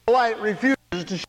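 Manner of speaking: high, loud fiery preaching; shouted preaching. Acted. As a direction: thunder it from the pulpit. Preaching at full force.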